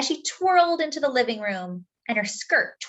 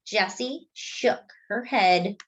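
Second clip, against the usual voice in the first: ordinary delivery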